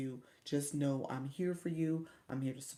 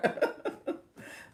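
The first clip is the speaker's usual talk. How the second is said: airy unvoiced chuckle; breathy laugh; 4 dB louder than usual. Real, not acted. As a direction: laugh at yourself. Laughing at own story.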